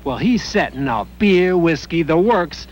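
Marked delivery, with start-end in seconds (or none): none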